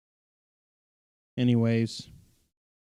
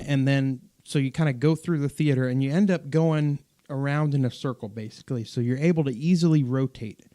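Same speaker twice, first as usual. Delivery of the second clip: normal talking voice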